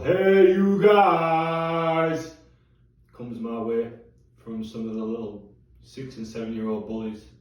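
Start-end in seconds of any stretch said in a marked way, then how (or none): 0.0-2.3 drawn-out, high, loud mock holler; imitated kids' yell across the street, sung out long; Sloth. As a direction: bellow it like a taunting catchphrase, stretching the last word. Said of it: "Sloth. You know, the occasional".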